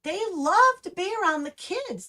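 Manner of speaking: ordinary delivery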